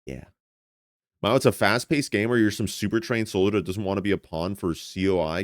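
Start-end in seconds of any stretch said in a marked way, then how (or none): none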